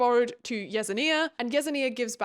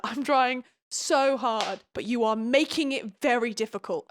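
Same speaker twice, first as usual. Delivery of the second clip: exasperated plea. Real, not acted. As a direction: stretch each word in frustration.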